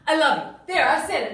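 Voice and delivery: loud, fast, get it over with; blurted out. Acted. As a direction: shout it out to be done. Finally blurts out her confession.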